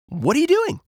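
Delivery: very high cartoon rabbit voice; squeaky-high question, rising at the end; curious rabbit. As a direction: ask as an eager little rabbit.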